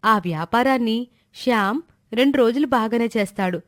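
ordinary delivery

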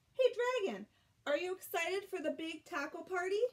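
high, bright call to a friend; excited call. Acted. Reader calling out to the dragon about the party, excited and high. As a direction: call out to him with glee.

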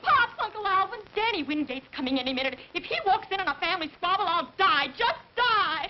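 loud, high panicked plea; frantic plea. Acted. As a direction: beg them to stop before he arrives. Family squabble, daughter panics.

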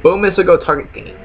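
Buffy speak. Shown as a demonstration. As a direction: say it like a dumb caveman. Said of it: "good example of fucking Buffy speak".

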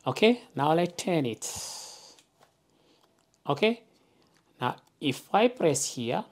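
ordinary talk

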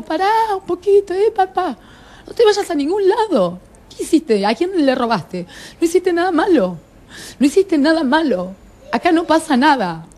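high, urgent retelling of a plea; retold pleading. To Marcelo, begging him to stay, high and urgent.

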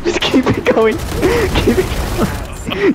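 high squeak through helpless laughter; wheezing with laughter. Real, not acted. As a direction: barely get it out for laughing. Helpless laughter while urging teammate.

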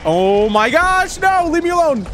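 drawn-out exclamation rising high; panicked plea. Real, not acted. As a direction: wail it as you're being chased. Chased by game enemy.

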